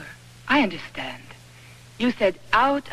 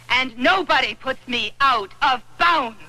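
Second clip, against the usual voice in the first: ordinary talk, nothing marked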